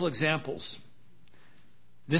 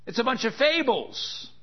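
mocking skeptic; 8 semitones higher than usual. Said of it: "be willing to put that to the test and say".

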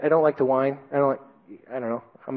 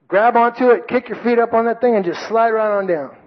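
urging instructor; 8 semitones higher than usual. Retold or imitated. Instructor urging him onto zip line.

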